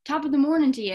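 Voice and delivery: mock Irish accent, over the top; stage Irish accent. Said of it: "I had to sound like".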